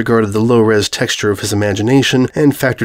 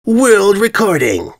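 proud; 10 semitones higher, slower than usual. A narrator's reading.

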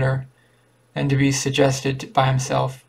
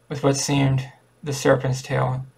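plain reading voice

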